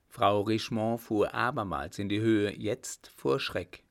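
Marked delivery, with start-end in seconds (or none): none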